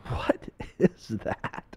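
slow, breath-only question; acted whisper, drawn out in disbelief; hushed bafflement. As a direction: whisper it slowly, word by word. Puzzled friend hears odd noise.

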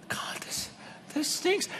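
breathy whispered prayer to God; hushed, disappointed whisper. Retold or imitated. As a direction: whisper it like a private confession. Private prayer remembered, whispered.

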